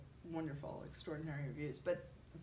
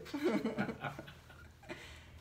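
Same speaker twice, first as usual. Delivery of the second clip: real laugh, higher, breathy; 9 semitones higher than usual. Real, not acted. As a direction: crack up at your own line. Laughs after her own ultimatum.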